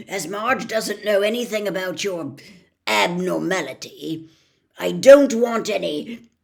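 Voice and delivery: loud bullying threat; menacing uncle. A narrator's reading. Vernon threatening Harry.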